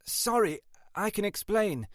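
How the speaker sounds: high-pitched flustered apology. A narrator's reading. Late sergeant explaining to her boss, high and flustered. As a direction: plead your excuse, pitched well up.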